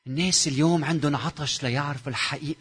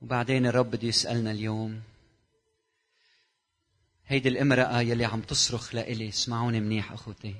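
ordinary talk, nothing marked

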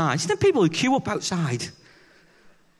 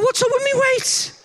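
mock offended; 3 dB louder than usual. Retold indignant reply to wife.

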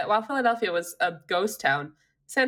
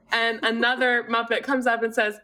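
ordinary talk

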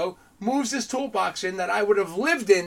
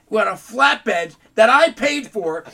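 mock outraged; 5 semitones higher, 10 dB louder, slower than usual. Retold angry boss.

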